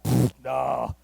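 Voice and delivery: dismissive. Retold or imitated. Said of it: "I'm like".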